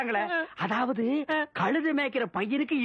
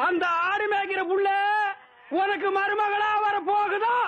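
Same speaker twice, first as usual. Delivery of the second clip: mocking yell; 6 semitones higher than usual. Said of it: "ஏன்டா இந்த கத்து கத்துற?".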